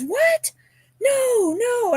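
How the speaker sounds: squeaky high no-no of surprise; stunned disbelief. Retold or imitated. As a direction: play the girl who can't believe it's free. Girl stunned by a free gift.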